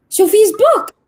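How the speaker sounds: high, loud gush; excited announcement. Retold or imitated. Teacher showing a colleague the book, high loud excitement. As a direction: announce it thrilled to the room.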